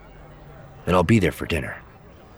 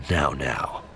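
mild, soft chiding; low, understated reproof; 4 semitones lower, 6 dB quieter than usual. A narrator's reading. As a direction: chide gently, dropping low and quiet. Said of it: "he chided".

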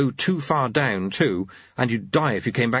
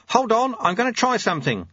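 high-pitched warning call; contained shout to a passenger; 11 semitones higher than usual. A narrator's reading. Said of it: "shouted Lutze".

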